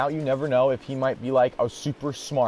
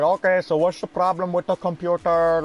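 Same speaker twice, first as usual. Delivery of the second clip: put-on Indian tech support accent; 2 semitones higher than usual. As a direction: imitate the call-center agent's Indian accent. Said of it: "And he's like".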